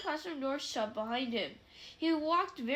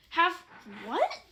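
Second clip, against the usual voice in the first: surprised; 3 semitones higher, 5 dB louder than usual. A narrator's reading. Harry startled by offer.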